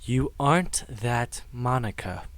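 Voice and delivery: slow, gentle reassurance; tender and hushed, unhurried. A narrator's reading. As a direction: speak to her softly, taking your time. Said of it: "I lower my voice and speak to her softly".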